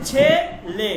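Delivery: "ছেলে" slowly split into chhe and le; slow teaching demo of "ছেলে"; drawn-out e vowel. Shown as a demonstration. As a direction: hold each e in "ছেলে". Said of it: "এইভাবে উচ্চারণ করবেন".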